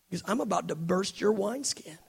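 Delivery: whispered and hushed, like a secret threat; dramatic whisper. Acted. Preacher to the congregation, voicing Jesus in a whisper. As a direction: lean in and breathe the words out. Dramatic line after a pause.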